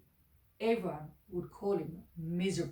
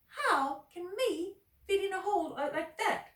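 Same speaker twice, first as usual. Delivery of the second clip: high, louder disbelief; much higher scoffing question; Uppity mocks the idea of the hole; incredulous squeak; 12 semitones higher, 5 dB louder than usual.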